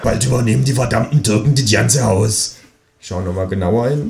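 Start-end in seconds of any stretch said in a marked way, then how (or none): 0.0-2.6 grumbling Berlin neighbour; downstairs neighbour. Acted. Neighbour complains about foreigners in the house, grumbling in Berlin dialect. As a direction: grumble as the bigoted woman downstairs. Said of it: "fragt sie".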